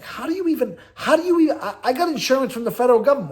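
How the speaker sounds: high, rising disbelief; how could she possibly know that; incredulous. Real, not acted. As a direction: blurt it out in amazement. Disbelief at the hospital intake.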